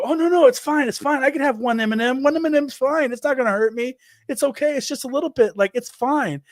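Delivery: fake reassuring dad. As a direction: oversell that everything is fine to the kid. Dad pretending to reassure his son.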